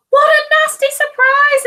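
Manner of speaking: high, loud storybook alarm; nasty-surprise read-aloud; dramatic alarm. A narrator's reading. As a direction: gasp it out for the children.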